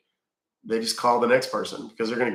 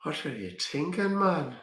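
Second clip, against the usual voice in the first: higher, softer attempt at Irish; Irish accent; 5 semitones higher, 6 dB quieter than usual. Shown as a demonstration. Streamer showing off an accent that slips, Irish accent. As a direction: lilt upward in a put-on Irish accent. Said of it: "that kind of bled into Irish".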